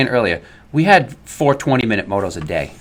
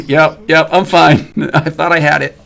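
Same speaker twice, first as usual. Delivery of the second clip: higher, amused mock-brave reply; higher-pitched retelling through laughter; imitated fallen rider; 6 semitones higher, 5 dB louder than usual. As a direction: act out a downed rider brushing it off.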